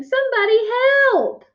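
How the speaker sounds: high-pitched panicked call; acted scream, wobbling off balance. A narrator's reading. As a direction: cry out for anyone to save you. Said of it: "she screamed".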